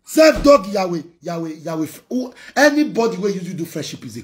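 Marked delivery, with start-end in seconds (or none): 0.0-0.7 louder, higher jab of the insult. Real, not acted. Vlogger throwing the insult back at an enemy, louder. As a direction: hit the insult hard the second time.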